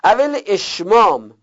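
usual speaking voice

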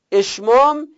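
اشمام said high and stressed; ishmam term. Shown as a demonstration. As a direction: lift اشمام as a key term. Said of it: "یه جوری بگی که کسره باشه ولی بوی ضمه هم بده".

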